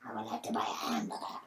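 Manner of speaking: whispered British accent. Shown as a demonstration. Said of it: "in a British accent?".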